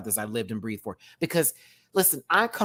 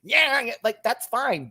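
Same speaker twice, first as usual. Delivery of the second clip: high-pitched imitation of nagging; hammed-up high yapping; mock yelling; 9 semitones higher than usual. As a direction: mimic someone yelling on and on. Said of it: "You keep yelling at us about it".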